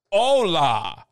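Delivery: loud, drawn-out fake Spanish accent; bad Spanish accent. Pretending to be Spanish to fool a cop, bad Spanish accent. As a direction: badly fake a Spanish speaker, loud and halting. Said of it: "I pretend to be Spanish".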